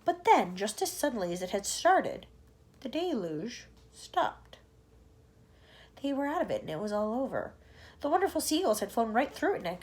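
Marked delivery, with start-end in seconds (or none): none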